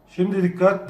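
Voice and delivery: ordinary talk, nothing marked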